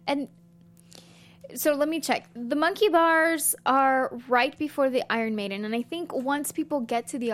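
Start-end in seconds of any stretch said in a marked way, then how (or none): none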